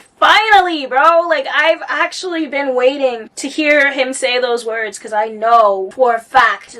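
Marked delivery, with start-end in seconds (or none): 0.0-1.2 high, loud cry of relief; loud, happy outburst of finally; excited relief. Retold or imitated. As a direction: shout it like you finally got the answer. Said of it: "I was like".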